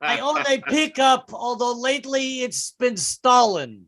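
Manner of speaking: Russian insurgent badly passing as Southern; fake Southern accent, Russian underneath. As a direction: boast like a local in a thick Russian accent. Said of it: "they would try to fake one".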